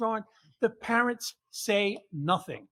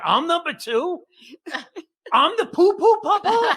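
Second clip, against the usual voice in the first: 高声又带笑的难以置信; indignant disbelief; 8 semitones higher, 8 dB louder than usual. Retold or imitated. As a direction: voice jumps up high and louder, laughing in disbelief. Outraged at being Pawpaw two.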